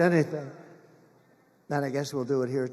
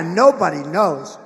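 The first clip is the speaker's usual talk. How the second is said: ordinary talk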